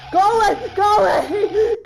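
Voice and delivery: high, loud repeated command; panicked shout. Real, not acted. Player ordering the monster away, panicked shout. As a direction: yell it, drawing out the second.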